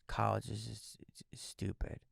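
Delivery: dropping to a near whisper; soft dismissive whisper; hushed scoff. Retold or imitated. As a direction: mutter it under your breath like a sulky teen. Mocking his younger self's view.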